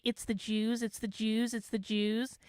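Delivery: mocking repeated chant; mock drone. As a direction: parrot the slogan dismissively. Mocking the repetitive posters.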